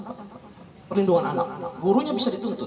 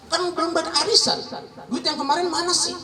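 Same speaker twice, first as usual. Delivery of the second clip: istri menuntut dengan suara jauh lebih tinggi; suara istri menagih; 10 semitones higher than usual. Retold or imitated. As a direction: tiru istri yang kesal minta uang arisan. Istri menagih uang arisan. (nada tinggi istri yang merengek minta duit)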